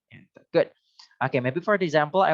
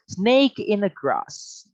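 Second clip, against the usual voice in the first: slow, higher model phrase; slow model reading; 6 semitones higher, much slower than usual. Shown as a demonstration. Teacher modelling the idiom slowly for students. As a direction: draw it out for the class to repeat.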